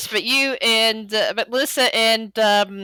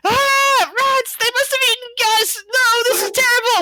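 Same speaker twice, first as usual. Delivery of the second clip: high, frantic cry of alarm; panicked elven voice; 12 semitones higher than usual. Acted. Elf in the kitchen cries out at the rats in alarm. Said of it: "you're hearing".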